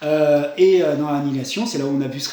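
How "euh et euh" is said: ordinary delivery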